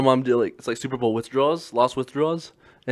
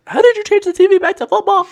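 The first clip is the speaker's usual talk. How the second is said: put-on very high, loud kid voice; imitated little sister; 10 dB louder than usual. Brother mimics nine-year-old sister baffled by the TV, mock amazement. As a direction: squeal it like an astonished child, playfully exaggerated.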